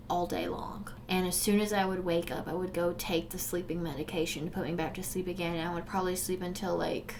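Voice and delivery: hushed. Real, not acted. Painful memory, voice drops.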